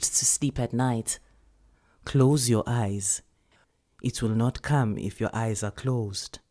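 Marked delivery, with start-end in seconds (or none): 2.0-6.4 soothing whisper. Acted. Grandmother whispering to the scared child at bedtime. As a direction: lull her gently to sleep. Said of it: "said Nkgonopeni".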